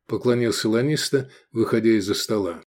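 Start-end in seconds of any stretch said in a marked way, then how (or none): none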